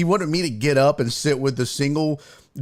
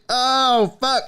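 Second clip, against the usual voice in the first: exasperated; 8 semitones higher than usual. Retold or imitated. Exasperated inner reaction.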